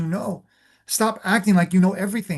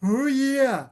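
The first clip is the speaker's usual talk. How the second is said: put-on high, far-off giggling baby voice; a proud little baby laughing it off; happy baby; 6 semitones higher than usual. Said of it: "the baby will be like".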